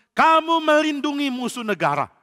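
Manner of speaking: angry king accusing the priest. Acted. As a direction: throw the charge at him.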